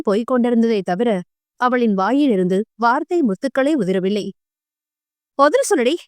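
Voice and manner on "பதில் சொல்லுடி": scolding. Acted. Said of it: "அதட்டினாள் Bhanumathi".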